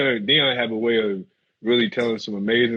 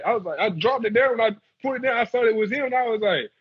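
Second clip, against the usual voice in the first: ordinary delivery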